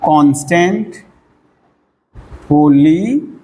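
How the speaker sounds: "Constant polynomial" broken up with long pauses, pitch rising on each piece; piecemeal dictation. Shown as a demonstration. Teacher writing out the term for students, halting piece by piece.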